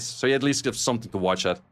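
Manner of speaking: usual speaking voice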